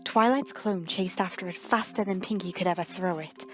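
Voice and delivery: ordinary delivery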